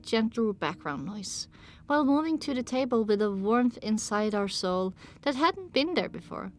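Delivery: ordinary talk, nothing marked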